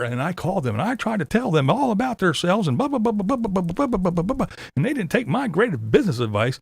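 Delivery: high whiny mocking Tater voice. YouTuber mocking Tater's museum complaint, high whiny imitation. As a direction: whine it like a self-important complainer.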